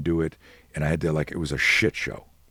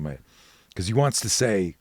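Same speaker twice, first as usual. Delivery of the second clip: usual speaking voice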